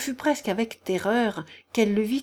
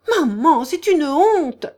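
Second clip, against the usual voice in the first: high, loud, drawn-out protest; crying out; 8 semitones higher, 10 dB louder, slower than usual. A narrator's reading. Daughter protesting at her mother's outburst, crying out. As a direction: cry out in indignation. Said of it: "cria Aglaé".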